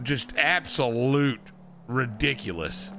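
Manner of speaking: ordinary talk, nothing marked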